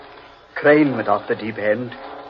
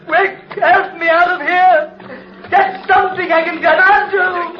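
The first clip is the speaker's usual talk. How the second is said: much higher, shrill terror; panicked cry for help; 3 dB louder than usual. Acted. Man sinking in quicksand crying to Humphreys, panicked. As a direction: yell in panic as you sink.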